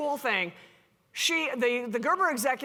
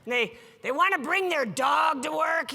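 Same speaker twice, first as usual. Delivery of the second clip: mock exasperated griping, higher and louder; frustrated executive rant; 4 semitones higher, 3 dB louder than usual. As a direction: vent like a stuffy boss fed up with millennials. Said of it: "they're, like, super frustrated".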